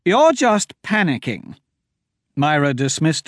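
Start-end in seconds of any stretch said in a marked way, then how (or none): 0.1-1.6 high, slow scornful dismissal; high scornful put-down; dismissive. A narrator's reading. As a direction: brush him off. Myra dismissing him.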